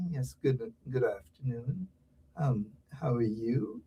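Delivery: hushed, low, hesitant politeness; mock a stiff, meek interviewer; timid show host. Mocking the polite shows they do, meek and hushed. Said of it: "them kind of shows they're doing".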